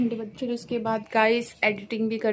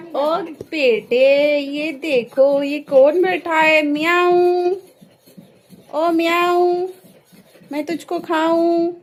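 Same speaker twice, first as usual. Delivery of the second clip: drawn-out, higher, louder cooing at a cat; playful pet talk; 5 semitones higher, 6 dB louder, much slower than usual. Pretend. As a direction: tease the kitty with long stretched words and loud meows. Teasing the cat in sing-song.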